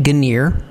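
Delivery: "Guineer" said with a hard g, ending like "near"; mispronounced genre. Shown as a demonstration. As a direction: say "Guineer" as guh-NEER. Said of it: "pronouncing it Guineer".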